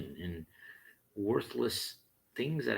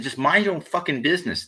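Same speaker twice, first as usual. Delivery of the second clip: loud, higher angry retort; angry retold snap; 8 semitones higher, 10 dB louder than usual. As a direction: spit it back at him, furious. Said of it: "And I said".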